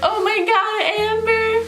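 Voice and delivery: high, aghast voice; shocked onlookers. Retold or imitated. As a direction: gasp her name in shock. People shocked at her.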